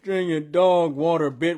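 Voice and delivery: cracking up mid-quote; quoting the bar, still laughing; laughing while quoting. Retold or imitated. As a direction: quote it through loud laughter. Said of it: "repeats the bar while laughing".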